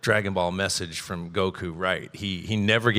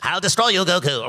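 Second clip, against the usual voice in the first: Frieza threat; 8 semitones higher than usual. Retold or imitated. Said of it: "or however he does it".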